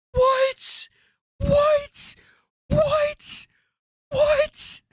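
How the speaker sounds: incredulous high squeaking what; stunned disbelief. Real, not acted. Streamer reacting to a clip, incredulous high what.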